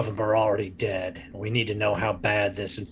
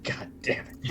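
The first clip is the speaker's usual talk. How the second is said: muttered curse; 8 dB quieter than usual. Acted. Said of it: "It's like".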